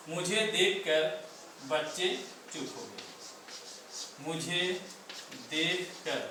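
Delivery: slow, word by word, long pauses between words; slow dictation. Shown as a demonstration. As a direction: dictate it so students can write along. (धीरे-धीरे शब्द-शब्द लिखवाना)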